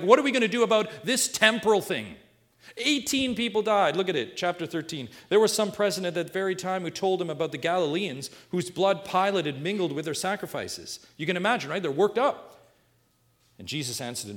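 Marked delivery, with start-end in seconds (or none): 0.0-2.2 high, loud mock agitation; worked up. People bringing current events to Jesus, worked up. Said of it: "People are worked up to Jesus". 2.8-12.5 raised, urgent pulpit voice; forceful preaching. Real, not acted. Preacher retelling the tragedy in Luke thirteen, forceful preaching.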